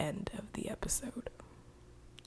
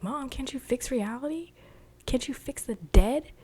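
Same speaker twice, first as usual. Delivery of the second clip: higher voice, imitating children asking mom; lightly voiced child's plea, pitched up; put-on high pleading questions; pleading kids; 7 semitones higher, 3 dB louder than usual. Twins voiced pleading with their mother.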